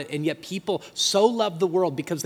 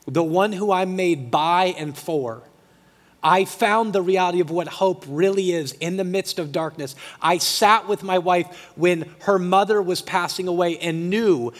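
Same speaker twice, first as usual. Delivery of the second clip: louder, rising preacher's build; fervent preaching; 3 semitones higher, 4 dB louder than usual. Acted. Pastor preaching to the church about hope in grief, louder and fervent. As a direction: press the hope home to the congregation.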